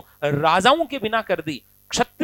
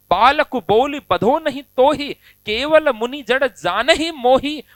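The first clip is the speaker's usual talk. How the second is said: angry Parashurama; 4 semitones higher, 5 dB louder, slower than usual. Acted. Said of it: "Parshuram जी को गुस्सा आ गया".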